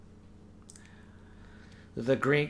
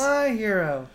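much higher, swooning; swooning damsel; 9 semitones higher, 5 dB louder, slower than usual. Acted. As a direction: sigh it with adoration. Acts out old melodrama.

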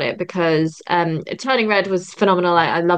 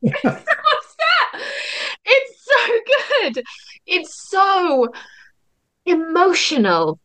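much higher, laughing and crying at once, slow; half-laugh, half-sob, overcome; crying on cue; 11 semitones higher, much slower than usual. Real, not acted. Guest tearing up over Pinocchio on the podcast, laugh-crying. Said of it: "I'm gonna cry on cue".

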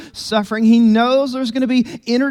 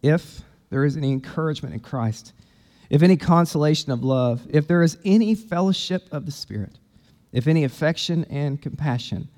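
scripture reading; 6 semitones lower, 3 dB quieter than usual. Acted. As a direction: read the verses quietly, letting each phrase land. Reads the scripture passage aloud.

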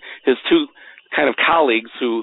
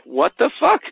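exasperated; 11 semitones higher than usual. Retold or imitated. Retold exasperation while watching the clock.

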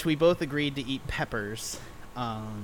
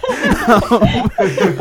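loud burst of laughter; 11 semitones higher, 13 dB louder than usual. Real, not acted. Caught off guard mid-sentence.

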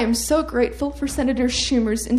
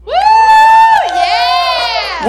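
much higher, louder whooping cheer; cheering shout; 14 semitones higher, 14 dB louder than usual. Real, not acted. Crowd member cheering for the debate winner, loud whoop.

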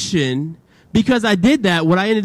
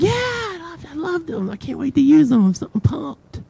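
forced enthusiasm; 9 semitones higher than usual. Retold or imitated. Faking joy over the gift.

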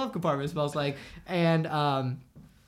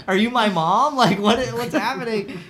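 indignant retort; 4 semitones higher, 6 dB louder than usual. Retold or imitated. Teen challenges the questioning cop.

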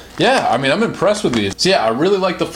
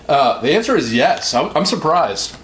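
normal talking voice

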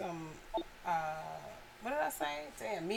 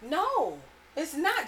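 normal talking voice

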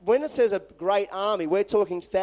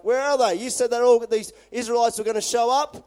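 louder and higher, exasperated demand; frustrated soldiers; 5 semitones higher, 6 dB louder than usual. Retold or imitated. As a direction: bark it out like a furious officer. Frustrated Aramean soldiers questioning scouts.

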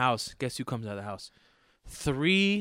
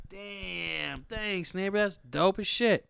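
pleased surprise; 8 semitones higher, much slower than usual. Retold or imitated. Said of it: "I was like".

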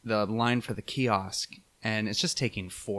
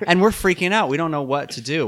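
retold panic; 8 semitones higher, 9 dB louder than usual.

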